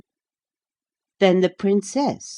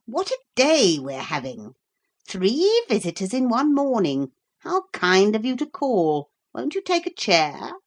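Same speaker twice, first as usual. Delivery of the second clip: lightly voiced fussy host, a bit higher; magician host; 7 semitones higher, 5 dB louder than usual. A narrator's reading. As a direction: greet the guest with mock charm. Magician greets visitor.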